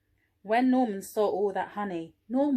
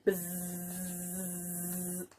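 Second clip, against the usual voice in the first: soft low imitated bee buzz; quiet buzzing sound; low buzzing noise; 5 semitones lower, 10 dB quieter than usual. A narrator's reading. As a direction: make the bee's buzz.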